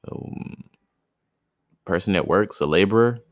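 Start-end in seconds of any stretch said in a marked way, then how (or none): none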